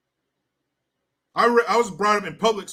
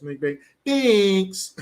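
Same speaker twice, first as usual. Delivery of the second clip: smug South Park voice; much slower than usual. Retold or imitated. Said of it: "South Park Prius drivers smug".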